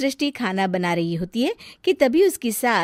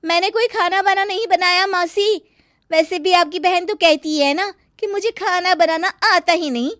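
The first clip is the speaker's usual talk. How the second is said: higher, sarcastic daughter-in-law; sharp retort; 9 semitones higher, 5 dB louder than usual. Acted. Srishti snapping back at the aunt, higher and sarcastic. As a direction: throw her words back at her.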